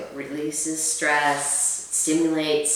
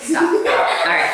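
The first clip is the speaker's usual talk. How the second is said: laughing; 7 semitones higher, 6 dB louder, much slower than usual. Real, not acted. Laughing at Doritos tongue.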